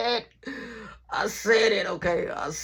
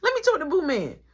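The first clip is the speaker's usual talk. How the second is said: blunt demand; 6 semitones higher, faster than usual. Retold or imitated. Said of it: "Straight like that".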